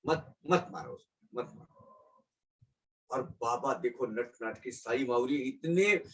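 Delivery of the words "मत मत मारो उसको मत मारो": soft, fading into a whisper; hushed plea. Acted. Baba begging Kaka to spare the goat, hushed plea. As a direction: let the plea die away to almost nothing.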